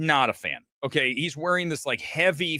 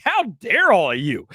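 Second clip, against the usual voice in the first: louder, raised mock outrage; mock indignation; 4 semitones higher, 5 dB louder than usual. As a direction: play at being offended by the crowd. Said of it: "And I'm like".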